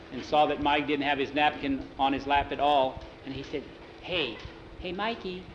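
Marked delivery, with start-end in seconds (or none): 4.0-5.4 soft call rising to a high yoo-hoo; discreet call. Acted. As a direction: quietly get a friend's attention, ending in a high singsong. Getting Mikey's attention across the table.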